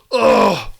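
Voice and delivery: high, loud disgusted groan; strained ugh of embarrassment; loud cringing groan. Real, not acted. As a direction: let out a loud groan of shame.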